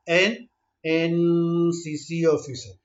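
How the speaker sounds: very slow, each sound drawn out; slow motion. Shown as a demonstration. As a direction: stretch every syllable slowly. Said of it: "इसको हम slow motion में देखते हैं".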